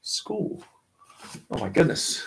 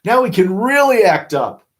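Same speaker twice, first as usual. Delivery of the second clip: put-on gleeful kids; 8 semitones higher, 6 dB louder than usual. Kids celebrating teacher's absence.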